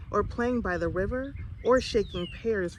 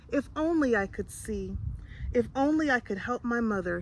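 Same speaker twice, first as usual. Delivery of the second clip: usual speaking voice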